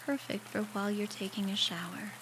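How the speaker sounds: guided meditation. Acted. Guiding a listener through a shower meditation, calm and unhurried. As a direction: ease the listener gently through each step, leaving space to breathe.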